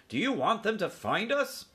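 exasperated uncle, pitch jumping up; ordering uncle. A narrator's reading. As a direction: rebuke the noisy youngsters. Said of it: "ordered Uncle Lester".

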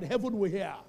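ordinary talk, nothing marked

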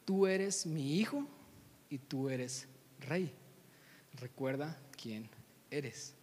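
slow and spaced, sinking low and soft; grave, measured declaration with long pauses; solemn fatherly charge. Acted. Mufasa telling Simba he is king, slow and solemn.

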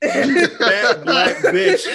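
high, drawn-out words through big laughter; laughing quote. Retold or imitated. Podcast host repeats the movie wife's insult, cracking up laughing. Quoting the clip while cracking up.

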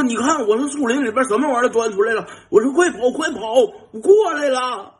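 high, loud mock-scared shout while riding off; teasing yell. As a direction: yell a fake alarm to scare a friend. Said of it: "我边跑边喊". (一边骑车逃跑一边假装惊恐地高声大喊)

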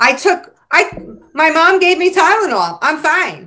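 put-on squeaky kid insisting he's fine; high mock kid voice. Mocking sick kids.

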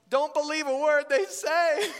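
loud, high mock warning; hammed-up warning. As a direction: call it out like an overprotective brother. Acting out warning to his sisters.

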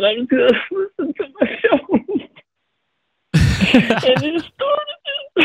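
high, squeaky voice breaking with laughter; gülmekten kırılarak. Real, not acted. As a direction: try to talk through helpless laughter. Caller cracking up while telling.

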